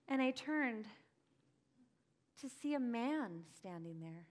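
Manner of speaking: usual speaking voice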